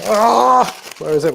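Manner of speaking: loud high panicked cry; frantic search, voice jumping up. Real, not acted. Searching under time pressure.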